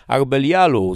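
high, loud desperate summons; calling out. A narrator's reading. Despairing prisoner summons the demon Belial, calling out. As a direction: cry out to summon a spirit. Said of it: "zawołałem".

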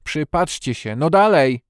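imperious shout; shouted command. A narrator's reading. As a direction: goad him loudly to look. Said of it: "krzyknął na Bena".

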